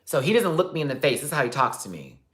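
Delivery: ordinary delivery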